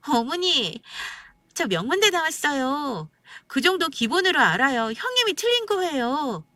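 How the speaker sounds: high, whiny sister-in-law voice; acted petulant protest, climbing at the end; stubborn insistence. A narrator's reading. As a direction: plead your case to mother-in-law, pitched right up. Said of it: "또 이러고 우기더라고요".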